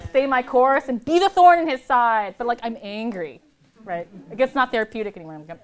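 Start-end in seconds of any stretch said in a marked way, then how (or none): 0.0-2.3 heated. Real, not acted. Angry retelling of pool fights.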